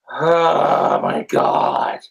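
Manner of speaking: ordinary delivery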